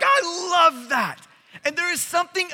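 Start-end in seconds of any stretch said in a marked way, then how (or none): 0.0-1.3 slow, loud, high delight; thrilled. Retold or imitated. Preacher reliving the moment, loud and delighted. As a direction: savor every word with joy. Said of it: "I'm like".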